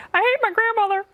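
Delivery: high, whiny put-on voice; mock congresswoman voice. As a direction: parody her excuse. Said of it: "she explained why".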